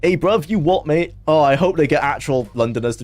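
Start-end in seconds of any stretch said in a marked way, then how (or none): 0.0-1.1 higher-pitched, hammed-up London street accent; lightly put-on London accent greeting; mock Londoner. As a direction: play at being a Londoner. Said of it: "Can't wait to hear from Fallout Londoners".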